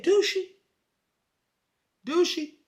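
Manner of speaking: high-pitched mock doubt; pointed skepticism. Therapist doubting his wife loves him, skeptical.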